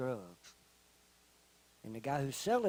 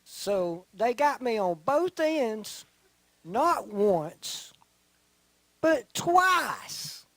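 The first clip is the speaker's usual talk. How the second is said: slow, loud, then twice shouted much higher; rising emphatic shout; 8 semitones higher, 9 dB louder, much slower than usual. Real, not acted. Telling the crowd he got caught twice, building to a shout.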